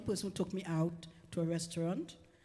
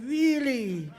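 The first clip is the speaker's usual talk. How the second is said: high, louder incredulous Really?; louder disbelief; 6 semitones higher, 8 dB louder than usual. Retold or imitated. To the boyfriend, louder incredulous Really?. Boyfriend says the mother died.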